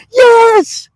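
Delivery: high, loud triumphant yes; emphatic shout. Acted. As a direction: shout it out like a winning answer. Emphatic answer repeated.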